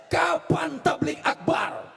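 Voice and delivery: slow, high, loud call from the dream visitor; booming question. Retold or imitated. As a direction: proclaim it slowly like a summons. (suara tinggi lambat dan keras seperti panggilan dalam mimpi)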